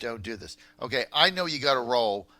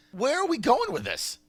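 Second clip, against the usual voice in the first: incredulous outrage; 9 semitones higher, 4 dB louder than usual. Real, not acted. Host outraged at judge ruling.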